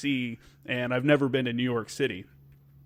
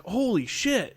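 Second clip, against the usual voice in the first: higher, stunned cry of disbelief; shocked exclamation; 8 semitones higher, much slower than usual. Retold or imitated. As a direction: blurt it out in sudden shock. Watching second plane hit live.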